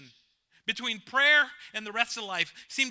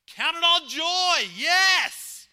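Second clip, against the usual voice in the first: 1 exultant; 10 semitones higher, 10 dB louder, much slower than usual. Acted. Preacher exclaims scripture joyfully.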